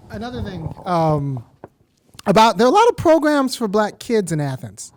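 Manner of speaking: usual speaking voice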